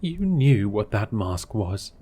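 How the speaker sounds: loud, slow accusing yell. Acted. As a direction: hammer the accusation at him. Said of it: "What are we yelling for?".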